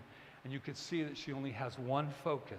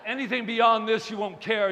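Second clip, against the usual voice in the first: triumphant call; 4 semitones higher, 11 dB louder than usual. Real, not acted. Speaker to applauding audience after a zinger, triumphant and loud. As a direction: boom it out, drawing out each word.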